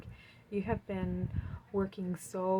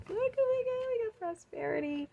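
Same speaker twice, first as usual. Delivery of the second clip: high-pitched delighted squeal, much higher and louder; thrilled; 3 dB louder than usual. Real, not acted. Tarot reader squealing to viewers over a lucky card. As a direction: burst out in joy at the card.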